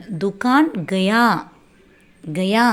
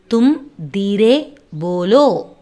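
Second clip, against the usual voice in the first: word by word. Shown as a demonstration. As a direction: separate தும், Dheere and polo for a learner. Teacher breaks phrase up for learners.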